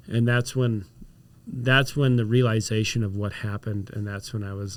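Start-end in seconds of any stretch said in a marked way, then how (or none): none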